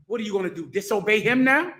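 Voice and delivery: normal talking voice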